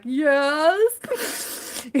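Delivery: high, drawn-out imitation of a tearful yes; mock bawling. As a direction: squeak it out like you're pretending to bawl. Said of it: "I was bawling the whole time".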